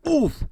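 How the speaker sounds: high loud grunt; winded by a collision; oof of impact. Acted. Gargoyles bump into each other.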